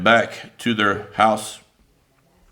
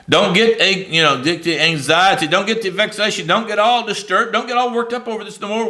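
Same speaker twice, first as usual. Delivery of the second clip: higher, building preacher urgency; fervent reassurance; 6 semitones higher than usual. Acted. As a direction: press every "don't" harder. Preacher voicing Eli's reassurance.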